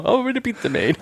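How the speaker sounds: lightly put-on Italian accent with a giggle; mock Italian accent. Joking to his podcast cohost in a mock Italian accent. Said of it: "That was my Italian accent".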